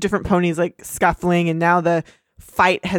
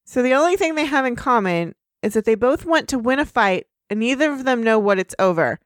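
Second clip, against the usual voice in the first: ordinary delivery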